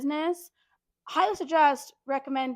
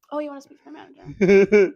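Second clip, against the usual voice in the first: laughing disbelief; 4 semitones lower, 9 dB louder than usual. Real, not acted. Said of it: "laughing disbelief".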